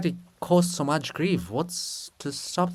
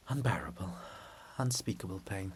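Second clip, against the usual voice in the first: hushed anguish; 7 semitones lower, 12 dB quieter than usual. Acted. Olha's grief over her lost body.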